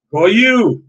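usual speaking voice

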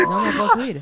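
high squealing laugh. Real, not acted. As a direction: laugh helplessly while struggling. Can't climb, laughing high.

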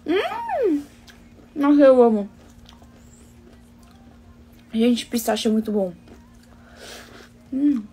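talking while eating; savoring after a bite; mouth with ice cream. Real, not acted. Seen 1: Bit cone at -4; holds cone, lips pursed, savoring while talking.

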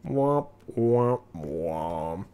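mock sad trombone, fading away. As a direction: sing the letdown trombone, dropping low. Disappointed by a non-holo rare.

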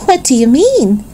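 high-pitched question with a touch of amusement; mildly amused. A narrator's reading. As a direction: lightly suggest a laughing lady, without laughing. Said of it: "asked the lady, laughing".

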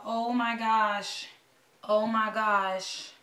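very slow, drawn-out oh my gosh; nervous anticipation. Real, not acted. Fan watching the last thirty seconds tick down, nervous and drawn-out. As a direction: stretch each word out as the nerves build. Countdown to ticket sale, nervous.

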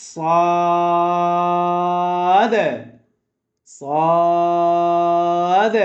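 সাদ held very long, thick and full; teacher leading class to chant সাদ; repeat-after-me demo. Shown as a demonstration. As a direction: draw out সাদ slowly, twice. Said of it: "সবাই বলেন".

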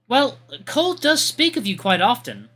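high, prim and courteous voice; a proper knight's gentle politeness; polite young lady. Acted. Artemy, sincere and reassuring.

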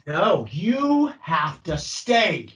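each word drawn out slowly; slow, firm refusal to let go; slow insistence. Shown as a demonstration. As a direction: stretch it out to hold them there. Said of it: "it's like inflection".

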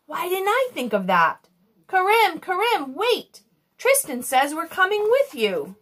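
high, urgent call into the distance; calling after someone. A narrator's reading. Emma calling the departing genie back, high urgent call. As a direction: call out to stop them leaving.

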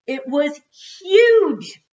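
loud, high, stretched-out amazement; awed excitement. A narrator's reading. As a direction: draw it out big, marvelling at the size. Awed climax of volcano description.

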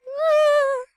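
short high scared yelp; a sudden frightened shriek; scared scream. Real, not acted. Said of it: "I'm scared".

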